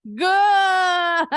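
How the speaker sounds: a loud, high shout of praise; excited praise. Real, not acted. Teacher praising a student's answer with a loud cheer.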